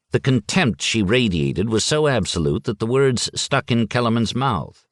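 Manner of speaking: plain reading voice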